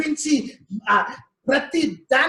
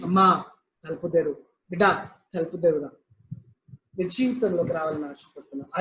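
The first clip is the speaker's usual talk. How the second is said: a hint of a gentle, pleading Jesus; Jesus knocking; 8 semitones lower, much faster than usual. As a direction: lightly voice Christ calling through the door. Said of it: "యెషూ Messiah తలుపు కొడుతూ ఉన్నాడు".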